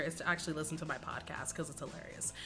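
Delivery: whispered conspiratorial plug; playful whispered hint to viewers. Real, not acted. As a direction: lean in and whisper the suggestion. Teasing podcast plug.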